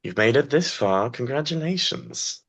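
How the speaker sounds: slow sultry villainess drawl; breathy vintage purr. Acted. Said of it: "and she goes".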